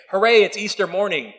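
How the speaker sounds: bright, loud mock celebration; cheery hooray. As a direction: cheer it like a sarcastic party host. Mock cheer on Easter morning.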